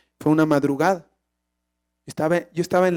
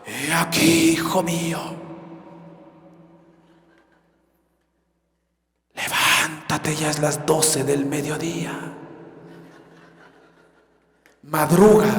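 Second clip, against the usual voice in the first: low, slow, mock-mystical voice of God; voz mística de Dios; 5 semitones lower, 4 dB quieter, slower than usual. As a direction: intone it like a booming heavenly voice, drawn out. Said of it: "la voz de Dios es una voz mística". (voz de Dios mística, grave y lenta, en broma)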